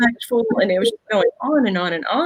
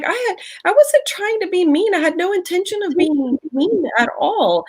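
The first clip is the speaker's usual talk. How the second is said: put-on much higher, earnest teenage voice; imitating her daughter pleading she meant no harm; teen daughter's protest; 11 semitones higher than usual. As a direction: pitch up as the upset fourteen-year-old. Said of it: "she's like".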